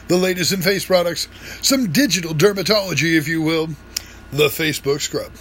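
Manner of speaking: fast, pushy TV pitchman; infomercial voice. Acted. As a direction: sell it like a late-night TV ad. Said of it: "And then the infomercial voice".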